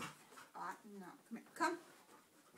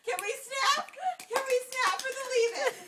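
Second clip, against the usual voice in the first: excited asking; 12 semitones higher, 12 dB louder than usual. Real, not acted. Excited request to film.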